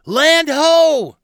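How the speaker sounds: high, full-voiced holler; shouted lookout call. A narrator's reading. Tuffy calls from the fighting top, shouting high and loud. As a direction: holler it long from high on the mast. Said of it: "Tuffy shouted".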